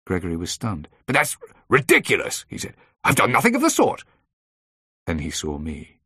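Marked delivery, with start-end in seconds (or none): 1.1-2.4 high, loud, stunned objection; indignant protest. A narrator's reading. Stunned man protests his arrest. 3.0-4.0 very high, loud protest; indignant denial. A narrator's reading. Arrested man denies the charge.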